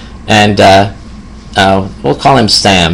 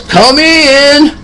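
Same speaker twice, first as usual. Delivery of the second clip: much higher called-out invitation; imitating the patient calling through the door; mock yell. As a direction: sing out come in from inside the house. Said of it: "he yells".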